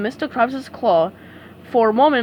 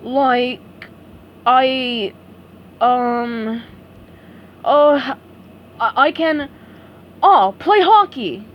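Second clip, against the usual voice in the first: halting, slow dopey search for words; thinking hard; 4 dB louder, much slower than usual. A narrator's reading. Patrick struggling to answer, halting dopey voice. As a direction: grasp for an answer. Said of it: "Patrick thought hard".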